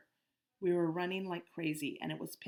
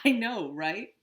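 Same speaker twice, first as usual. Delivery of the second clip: laughing; 5 semitones higher, 11 dB louder, slower than usual. A narrator's reading. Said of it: "laughed Amos".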